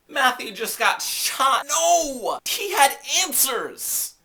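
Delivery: high, loud, drawn-out outcry; dismayed shouting. Acted. Fan reacts to Matthew getting shot, dismayed shouting. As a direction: wail in over-the-top dismay. Mock outcry at a character's death.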